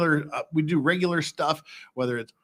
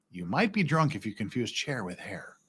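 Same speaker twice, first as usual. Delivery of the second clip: hushed whispered aside; whispering; 5 semitones lower, 8 dB quieter than usual. Acted. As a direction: whisper it like a secret.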